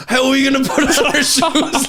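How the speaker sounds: high, laughing mock panic about the shoes. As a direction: squeak it out, half laughing, in mock alarm. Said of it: "I was just looking, going".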